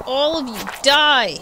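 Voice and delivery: loud angry shout. Real, not acted. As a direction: shout it at them. Yelling at monsters.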